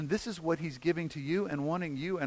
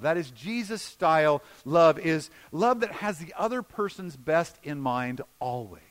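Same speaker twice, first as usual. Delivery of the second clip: ordinary talk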